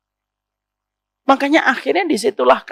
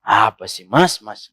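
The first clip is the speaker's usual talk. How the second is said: low, curt wifely annoyance; deep, irritated wife's retort; alto voice; 11 semitones lower, slower than usual. Acted. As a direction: drop the voice low to brush off the husband. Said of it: "Gitu, pakai suara alto".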